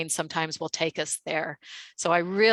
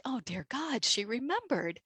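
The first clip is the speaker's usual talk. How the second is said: much softer, hushed dismay; dread; 4 semitones higher, 8 dB quieter than usual. Retold or imitated. Recognized by the angry customer, hushed dread. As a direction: mutter it under your breath in horror. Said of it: "And I'm like".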